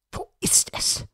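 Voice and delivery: tense, shaken whisper. A narrator's reading. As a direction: breathe the question in fear. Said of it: "flüsterte Holmes". (erschüttertes Flüstern)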